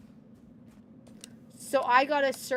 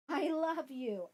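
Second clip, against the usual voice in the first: tender, slow; 6 dB quieter, slower than usual. Real, not acted.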